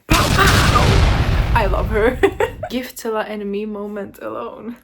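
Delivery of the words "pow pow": loud, high "pow pow"; two explosive hits in a row; double punch sound. Acted. Reader cheering the spying confession, loud punch noises. Cheering each verbal hit.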